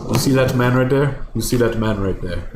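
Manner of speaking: put-on retelling it in a thick African accent. Homeless man approaches a girl, imitated African accent. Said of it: "now I remember the accent of this part".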